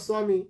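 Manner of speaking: স্বামী as swami, ব sounded like w; Hindi-style pronunciation. Shown as a demonstration. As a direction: say স্বামী with sw at the start. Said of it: "বফলার উচ্চারণ ডব্লিউ এর মতো". (স্বামী, হিন্দির মতো সোয়ামি)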